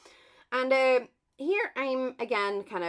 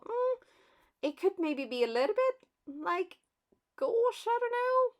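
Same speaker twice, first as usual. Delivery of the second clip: high-pitched, hesitant thinking aloud; doubtful musing; 11 semitones higher than usual. Retold or imitated. Crafter weighing up gold against silver, doubtful and high. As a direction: voice your own doubt in a high, wavering-between-options tone. Voicing her own doubtful thought.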